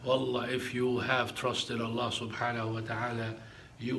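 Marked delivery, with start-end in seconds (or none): none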